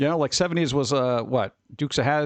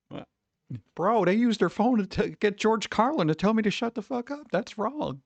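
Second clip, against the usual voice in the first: higher, incredulous mock outrage; stunned disbelief; 8 semitones higher than usual. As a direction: play a bro who can't believe what he heard. Imagined listener's stunned reaction.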